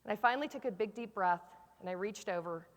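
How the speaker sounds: low, soft storytelling, fading; quiet, earnest resolution; hushed climax. Retold or imitated. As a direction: let the ending sink to a hush.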